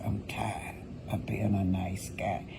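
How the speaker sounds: hushed whisper imitating him; retelling his line in a sly whisper; whispered quote. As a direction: breathe it out in a mocking whisper. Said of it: "He says".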